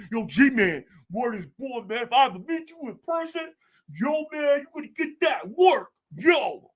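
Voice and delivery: high mocking tough-guy voice; high hammed-up street threat. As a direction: threaten him as a mocked street thug.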